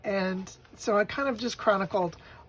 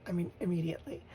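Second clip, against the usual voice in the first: breathy hushed aside; whispered in wonder; 2 semitones lower, 7 dB quieter, faster than usual. Real, not acted. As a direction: drop to a whisper, awed. Whispered aside about first kiss.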